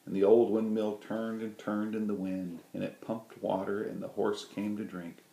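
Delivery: ordinary delivery, nothing marked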